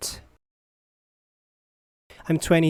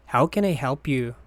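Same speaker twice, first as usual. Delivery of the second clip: ordinary talk, nothing marked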